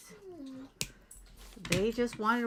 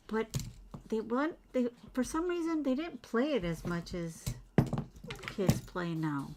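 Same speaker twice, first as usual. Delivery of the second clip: ordinary delivery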